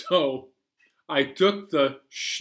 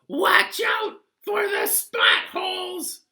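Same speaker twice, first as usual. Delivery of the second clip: odd passenger voice; 5 dB louder than usual. Acted. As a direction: squeak it out like a goofy know-it-all. Passenger voice warning.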